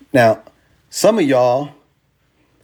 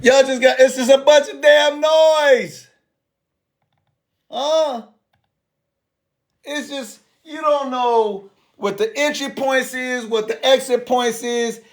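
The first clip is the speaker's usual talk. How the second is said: much higher, worked-up exclaiming; excited disbelief; 9 semitones higher, slower than usual. Real, not acted. Mocking chaotic crypto portfolios to viewers, high excited rant. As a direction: rant it, pitch way up.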